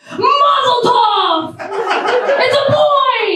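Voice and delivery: high, drawn-out exultant cry; mock celebratory cheer. Onlooker announcing a birth, mock celebratory cry. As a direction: proclaim it like joyous birth news.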